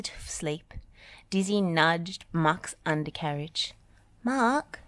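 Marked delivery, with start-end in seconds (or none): none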